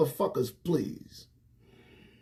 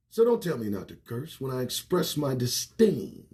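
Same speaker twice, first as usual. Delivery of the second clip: ordinary delivery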